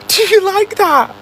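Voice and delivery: high, drawn-out laughing repeat; laugh, then squeal it slowly; shocked laughter. Real, not acted. Driver repeating the car's cheeky question, laughing high.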